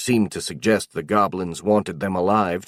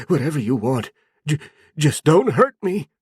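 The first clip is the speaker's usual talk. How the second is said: high, stammering begging; terrified pleading; 7 semitones higher than usual. A narrator's reading. Zeno begs the goblin shaman, terrified. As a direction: beg him to spare you.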